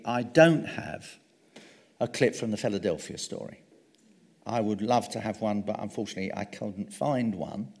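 usual speaking voice

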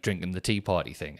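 normal talking voice

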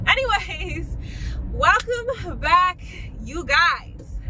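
high, loud, slow welcome; bubbly YouTuber welcome back; vlog intro. Acted. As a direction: stretch out the welcome like a host.

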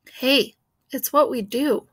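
usual speaking voice

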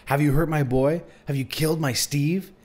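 high, urgent accusation; screeched. A narrator's reading. Mother demanding if the boy hurt her son, restrained screech. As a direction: screech it in a contained storyteller's way. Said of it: "she screeched".

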